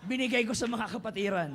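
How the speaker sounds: usual speaking voice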